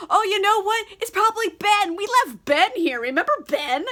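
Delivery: acted sudden realisation. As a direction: play pirates excitedly working it out. Pirates suddenly realise who it is.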